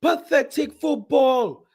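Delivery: high, slow jeering yells at the players; angry fan heckling. Retold or imitated. Fan in the Old Trafford stands yelling at players, angry. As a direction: bellow at the pitch like a fed-up fan. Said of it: "That's what I'm hearing in Old Trafford".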